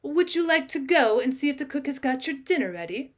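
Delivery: ordinary delivery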